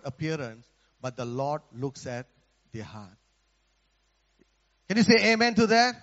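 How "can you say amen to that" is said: raised, higher call to the congregation; calling for amen. Real, not acted. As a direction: rouse the room to answer amen. Preacher calls on congregation.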